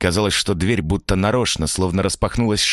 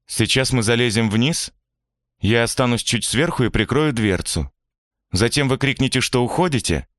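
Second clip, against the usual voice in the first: put-on higher, lighter young man; younger man voice; 8 semitones higher than usual. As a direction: voice him higher and earnest. Vitya explains the plan.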